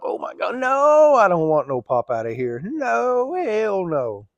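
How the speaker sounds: high, loud, long-drawn no; dramatized, stretched-out refusal; emphatic refusal. Retold or imitated. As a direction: wail each "no" in mock horror. Reliving his refusal to go out.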